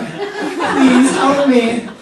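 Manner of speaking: slow, laughing mock plea; puppy dog plea. Storyteller pleading to the officer, mock pitiful. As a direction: beg the cop like a lost puppy. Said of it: "my human most puppy dog eyes".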